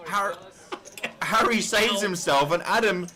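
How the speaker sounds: high, rising excited call. Real, not acted. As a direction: shout the river card's surprise. River card saves two players.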